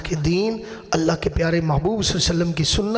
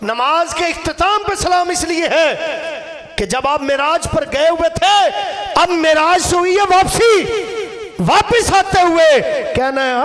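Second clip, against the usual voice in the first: loud, high-pitched impassioned sermon delivery; full-force preaching; 9 semitones higher, 5 dB louder than usual. Real, not acted. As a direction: hammer each phrase out to the crowd. (पूरे जोश में ऊँची आवाज़ में तक़रीर)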